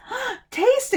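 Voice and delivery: loud, high gasp of excitement; eager urging. A narrator's reading. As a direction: urge them eagerly to try it. Boy urges villagers to taste.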